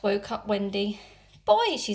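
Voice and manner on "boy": put-on startled high, loud "Boy"; surprised call. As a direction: catch his attention in surprise. Wendy wakes, sees crying boy.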